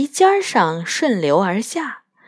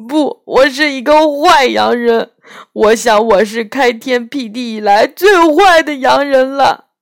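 a lightly voiced tearful faun, louder; weeping faun; 5 semitones higher, 6 dB louder than usual. Faun confessing his wickedness to Lucy, tearful faun voice. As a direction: play the ashamed faun in tears.

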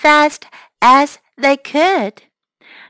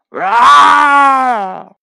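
loud monster roar; ogre roar; 6 dB louder than usual. Acted. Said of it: "吃人怪使劲儿地咆哮着".

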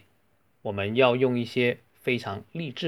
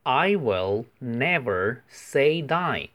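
each word drawn out slowly for learners; slow, careful model reading; much slower than usual. Shown as a demonstration. Teacher reading slowly to students, clearly. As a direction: spell it out slowly for the class. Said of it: "我讲解一下".

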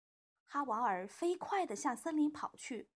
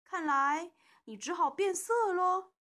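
louder and higher, teasing; loud taunt; 6 semitones higher, 7 dB louder than usual. A narrator's reading. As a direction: call it out loud to mock him. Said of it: "又大声说". (大声嘲弄)

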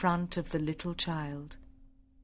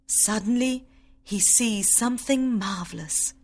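plain reading voice

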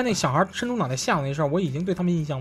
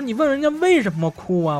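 higher-pitched retold protest, pushing on 为什么; insistent remonstration; 6 semitones higher, 4 dB louder than usual. Retelling how they challenged mum over coddling, insistent remonstration. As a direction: press the question harder, pitch lifted on 为什么.

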